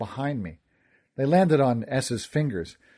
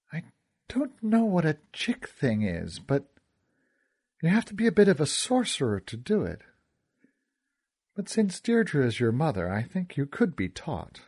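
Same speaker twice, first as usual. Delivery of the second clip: lightly voiced woman, pitched up, measured; Essa, composed young sorceress; 4 semitones higher than usual. Essa answering whether the firefly trick can be taught, composed. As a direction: explain it carefully in a raised voice.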